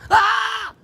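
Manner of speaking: high, loud mock scream; sudden high-pitched wail, put on; acted anguished cry. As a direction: cry out "ah" as if in panic.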